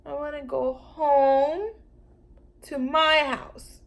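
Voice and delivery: slow, loud, high insisting; bossy demand. Acted. Lulu telling the Brontosaurus she wants home.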